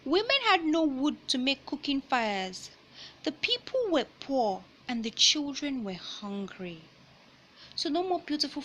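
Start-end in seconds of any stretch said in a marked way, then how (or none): 0.0-6.8 picture book read-aloud voice; reading to children. Acted. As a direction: clear storybook narration.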